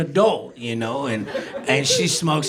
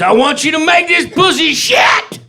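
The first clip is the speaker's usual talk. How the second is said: high, loud yelled demand; shouted demand; 11 semitones higher, 6 dB louder than usual. Retold or imitated. Girlfriend shouting her wilder demand at him, high and loud. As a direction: yell it like she's lost it.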